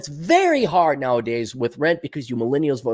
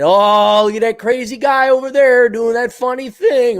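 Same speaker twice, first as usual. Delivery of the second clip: mocking critics; 8 semitones higher, 3 dB louder than usual. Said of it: "you all think I'm weird".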